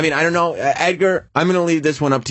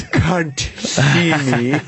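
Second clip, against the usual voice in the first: slow horse voice; much slower than usual. Pretend. Voicing the horse in photos.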